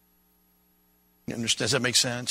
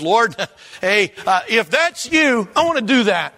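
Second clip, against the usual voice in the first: eager; 8 semitones higher, 7 dB louder than usual. Pretend. Peter's eager request.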